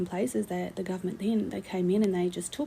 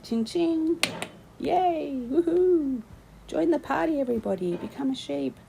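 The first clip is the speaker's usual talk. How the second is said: high mocking party cheer; sneering mock celebration, much higher; sarcastic cheer; 8 semitones higher than usual. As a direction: cheer them on with scorn.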